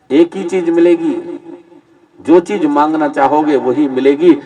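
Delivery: normal talking voice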